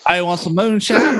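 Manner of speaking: high put-on Texan voice; mock Texas accent. Host joining the guest's bit, high mock Texas accent. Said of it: "do your Texas accent".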